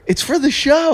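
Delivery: high-pitched self-justifying plea; high, defensive protest; pleading excuse. Acted. Insisting excuse to a hostile crowd.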